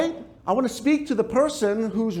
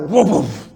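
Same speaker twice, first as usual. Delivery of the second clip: mock dog barking; 8 dB louder than usual. Angry child as animal.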